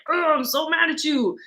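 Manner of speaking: light, put-on anger; mock angry. Shown as a demonstration. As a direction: play at being cross, without raising the volume. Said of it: "like, angry".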